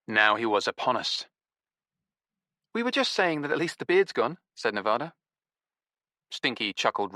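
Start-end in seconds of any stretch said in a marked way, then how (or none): 2.7-4.4 higher, mock-innocent jibe to his face; bright fake-sweet taunt; pointed mockery. A narrator's reading. Nevada repeats jibe to his face.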